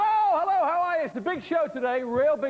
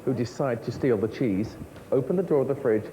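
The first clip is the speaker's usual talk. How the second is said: ordinary talk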